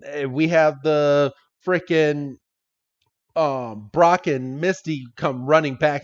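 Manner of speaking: ordinary talk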